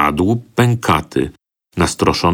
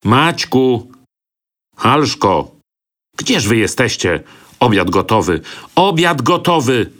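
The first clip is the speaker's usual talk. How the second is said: calling out; 5 semitones higher than usual. A narrator's reading. Servant calls the children for dinner. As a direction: shout down to find them.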